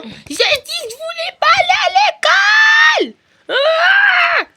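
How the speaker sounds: very high loud slow crying shout; toddler tantrum. Pretend. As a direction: scream and sob like a toddler in a tantrum.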